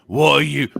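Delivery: usual speaking voice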